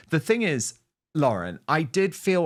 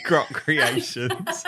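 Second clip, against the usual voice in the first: laughing; 3 semitones higher, much slower than usual. Real, not acted. Laughing at a fake AI clip.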